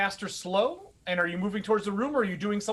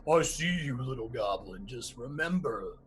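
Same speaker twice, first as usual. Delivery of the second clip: low, much softer menacing growl; Blythe's threat; 8 semitones lower, 6 dB quieter than usual. Acted. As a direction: threaten the little goblin quietly. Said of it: "Blythe looks up and goes".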